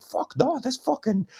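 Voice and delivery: high, stunned outburst; shocked disbelief. Retold or imitated. Throttled by the son, high shocked outburst. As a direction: squeak out your disbelief.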